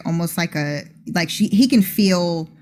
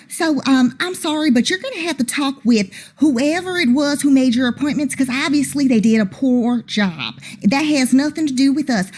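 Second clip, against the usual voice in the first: high, indignant fake Southern voice; huffy Southern belle, much higher; bad Southern accent; 8 semitones higher than usual. Angel brushing off the gentleman in a bad Southern accent. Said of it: "that bad Southern accent".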